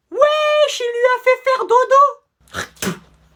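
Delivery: shrill high squeal, slowed right down; a high, stretched-out squeak of amazement; squeaky amazed. Acted. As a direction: squeal in disbelief, high and drawn out. Amazed voice after a knockout.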